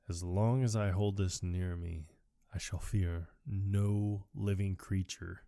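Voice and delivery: low, slow, hushed incantation; comic solemn proclamation; mock solemn vow. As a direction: intone it gravely, like a sacred oath. Acting Ace solemnly holding his medallion.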